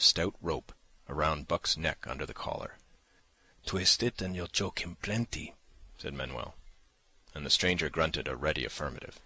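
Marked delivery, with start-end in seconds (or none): 3.6-5.5 Manuel's voice. A narrator's reading. Manuel voiced handing over dog.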